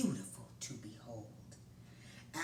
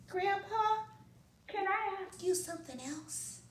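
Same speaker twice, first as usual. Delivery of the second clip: put-on soft little boy; 5 dB louder than usual. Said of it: "Mancho asked softly".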